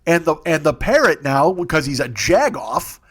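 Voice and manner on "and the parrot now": ordinary delivery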